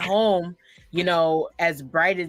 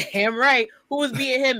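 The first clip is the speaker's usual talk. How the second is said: proud dad; 8 semitones higher than usual. Retold or imitated. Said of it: "my dad goes".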